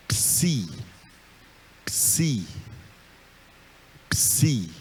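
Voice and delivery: psi said slowly and clearly, P and S both sounded; slow letter name. Shown as a demonstration. Teacher sounding out psi for students, slow and clear. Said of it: "Essa letra psi".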